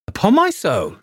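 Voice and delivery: a high, amused sardonic exclamation; laughing at the cheek of it; sardonic good humour. A narrator's reading. Father amused by the bold proposal, sardonic and high. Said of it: "with sardonic good humour".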